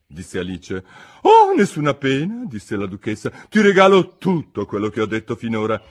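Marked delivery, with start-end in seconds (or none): 1.2-2.4 higher, louder, pompous duchess. A narrator's reading. Duchess lectures Alice, pompous and higher. 3.5-5.8 duchess voice. A narrator's reading.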